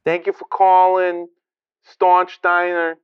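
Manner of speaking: waitress on the line; diner waitress. Acted. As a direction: answer the diner phone in a waitress's lilt. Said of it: "Marla, the waitress, and she goes".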